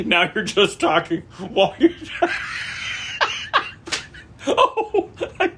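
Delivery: high squealing laughter; laughing. Real, not acted. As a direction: laugh through the words. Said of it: "laughing during talk".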